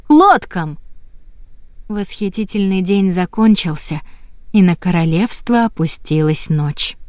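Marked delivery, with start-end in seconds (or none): 0.1-0.8 high shouted call; crier's shout. A narrator's reading. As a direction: call it out across the square. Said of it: "городской глашатай крикнул".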